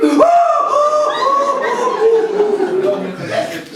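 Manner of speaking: mock boyish cackle; high giggling laughter. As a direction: laugh at him like naughty boys. Boys laughing at Krishna.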